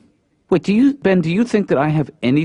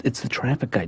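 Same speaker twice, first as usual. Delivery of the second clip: soft, awed hush; whisper-close in starstruck awe; dad softly announcing the traffic reporter; hushed excited; 8 dB quieter than usual. Real, not acted. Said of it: "Why are you talking like this?".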